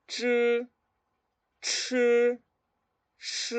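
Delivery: "ch", "ch", "sh" high and level, without air, aspirated, then blown; spaced retroflex drill syllables "ch, ch, sh"; model pronunciation. Shown as a demonstration. Teacher repeating for learners, model "ch, ch, sh". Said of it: "Vuelvo a repetirlo".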